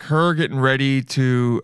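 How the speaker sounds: ordinary talk, nothing marked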